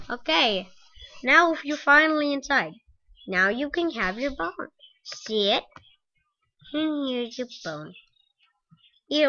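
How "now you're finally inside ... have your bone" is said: normal talking voice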